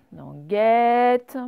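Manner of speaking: guette said slowly, loud and clear, stressing the è; over-articulated guette for children; sound demo. Shown as a demonstration. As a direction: stretch out guette so the è rings. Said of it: "teacher showing the sound".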